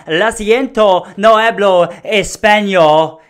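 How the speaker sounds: confident Spanish twang. Acted. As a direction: belt it out with a confident Spanish accent. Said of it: "if you say it with enough confidence".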